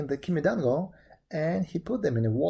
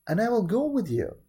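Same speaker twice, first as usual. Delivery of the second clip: raised storybook bird voice; pheasant voice; 6 semitones higher, 4 dB louder than usual. A narrator's reading. Pheasant offering to join Momotaro, raised bird voice. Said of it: "said the pheasant".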